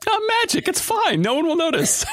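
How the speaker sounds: late-night editor talking himself into it, high and laughing; mock self-reassurance. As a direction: brush off the mistake in a sing-song. Mock self-talk while editing at night.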